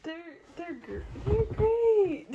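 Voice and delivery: high, slow words squeezed through laughter; breaking up with laughter mid-sentence; laughing through it. Real, not acted. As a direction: try to praise while cracking up. Laughing at his bad accents.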